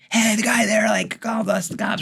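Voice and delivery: put-on high kid voice, lightly done; tattling kids. Kids telling the gangbanger dad about the store owner, lightly voiced whiny kids.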